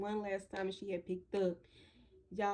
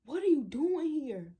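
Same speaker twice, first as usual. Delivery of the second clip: high, slow surprised question; surprised auntie; 6 semitones higher, 5 dB louder than usual. Retold or imitated. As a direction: ask it stunned and drawn out. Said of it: "she was like".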